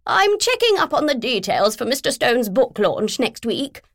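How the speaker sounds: brisk and self-important; posh woman. Acted. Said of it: "she said".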